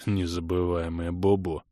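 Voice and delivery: low, muffled amused repeat; snorting echo. A narrator's reading. Kray mocking the girl's baby talk, low snorting echo. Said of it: "хрюкнул Крей".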